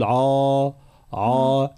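عا with an exaggerated heavy ع; wrong reading. Shown as a demonstration. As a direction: overdo the ع in عا. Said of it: "لا نقول".

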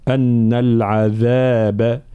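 أن العذاب recited slowly with a buzzing ذ; measured tajweed أن العذاب; voiced dhal. Shown as a demonstration. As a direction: hold the long vowels and voice the th of العذاب. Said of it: "كذلك حرف الذال تكرر".

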